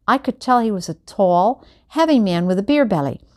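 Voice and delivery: ordinary delivery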